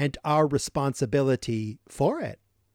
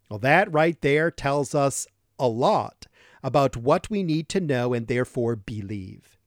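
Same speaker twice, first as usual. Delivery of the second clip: ordinary delivery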